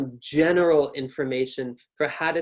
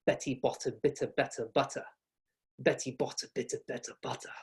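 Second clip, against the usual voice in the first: British crisp flicked T in Betty and better; British accent; 7 dB quieter than usual. Shown as a demonstration. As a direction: say Betty bought a bit of better butter with sharp British T's. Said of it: "in British English, my tongue is flicked".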